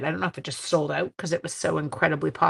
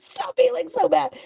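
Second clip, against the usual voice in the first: quoting a friend; 3 dB louder than usual. Retold or imitated. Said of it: "she literally said".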